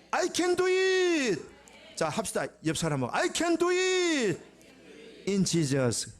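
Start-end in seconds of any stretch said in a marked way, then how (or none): 0.1-1.4 loud, high-pitched, drawn-out proclamation; rallying shout. Real, not acted. Congregational rallying declaration. 3.2-6.1 high, drawn-out congregational cheer; rallying shout. Real, not acted. Leads congregation in declaration.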